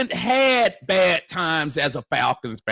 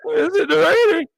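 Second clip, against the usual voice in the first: put-on high, loud childlike voice; little girl voice; 12 semitones higher, 5 dB louder than usual. A daughter asking her dad about tryouts, high childlike. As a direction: plead like a small daughter, way up. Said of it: "And she said".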